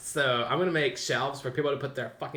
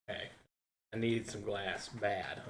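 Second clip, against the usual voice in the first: ordinary delivery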